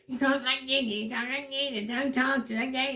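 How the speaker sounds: very high squeaky mocking character voice; Brian James voice. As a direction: mimic him way up in a pinched falsetto. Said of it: "Like Brian James".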